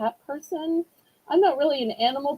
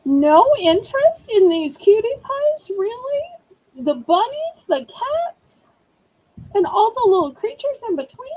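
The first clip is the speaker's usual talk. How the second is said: coaxing high voice; 9 semitones higher than usual. Real, not acted. Coaxing viewers about unsold socks.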